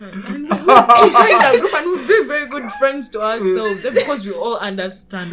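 sarcastic laughing shout. Real, not acted. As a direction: laugh it out loudly.

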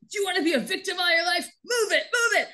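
high mock sergeant yelling orders; hammed-up drill sergeant shouting. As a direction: bark at the recruits to move. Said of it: "the drill sergeant's like".

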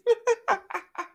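high burst of laughter; a giggle that escapes; stifled giggles. Real, not acted. Cracks up at own anatomy fact.

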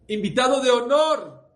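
high, loud proud announcement; emphatic invitation. Acted. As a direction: announce the honor with pride. Father insists on guest of honor.

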